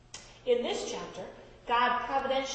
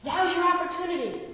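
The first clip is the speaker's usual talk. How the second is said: normal talking voice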